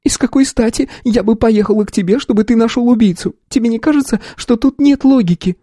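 a wounded sister protesting her innocence; sister near tears. A narrator's reading. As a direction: sister denies to her brother she is a killer, nearly crying.

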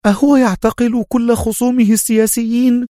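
high-pitched horrified woman's voice; startled, appalled question; alarmed. A narrator's reading. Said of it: "سألته في ارتياع".

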